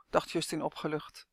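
plain reading voice

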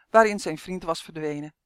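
ordinary delivery

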